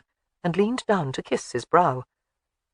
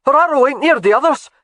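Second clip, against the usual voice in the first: put-on young soldier calling out, higher and louder; 9 semitones higher, 9 dB louder than usual. A narrator's reading. Young soldier calls out about her arrow, calling out. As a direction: shout it across the field. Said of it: "called out".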